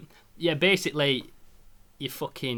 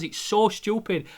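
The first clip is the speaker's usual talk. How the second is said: slow, higher teary voice; tearful repeat; 6 semitones higher, slower than usual. Retold or imitated. Admitting to his girlfriend it's silly, slow and teary. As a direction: say it through tears. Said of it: "I goes".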